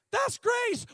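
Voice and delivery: high, loud preacher's shout. Real, not acted. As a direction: shout it to the back row. Shouted climax of sermon.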